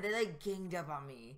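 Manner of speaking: ordinary talk, nothing marked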